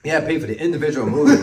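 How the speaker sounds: quick, lifted emphatic restatement; emphatic repeat. Real, not acted. Repeats it for the listener.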